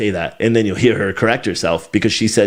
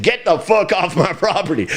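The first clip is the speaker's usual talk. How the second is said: mock angry homeowner; 7 semitones higher than usual. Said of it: "someone's like".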